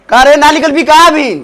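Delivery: high, loud angry call; impatient shouting. Acted. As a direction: yell for them to come out now. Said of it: "का चिल्लात हवा?". (खिसिया के चिल्लाइल)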